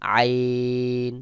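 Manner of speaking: আইন squeezed from the throat, very drawn out; Arabic আইন, slow and held on a level note; throat-pressed আইন. Shown as a demonstration. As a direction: chant a long আইন with slight throat pressure. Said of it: "গলা একটু চাপ দিয়ে উচ্চারণ".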